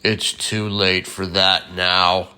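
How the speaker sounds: low, slow, booming beast; booming bear voice. A narrator's reading. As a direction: bellow it like a huge bear. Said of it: "a booming voice bellowed".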